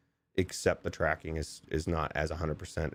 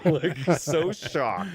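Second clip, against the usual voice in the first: laughing; 8 semitones higher, 5 dB louder, much slower than usual. Retold or imitated. Retelling friend's shocked face.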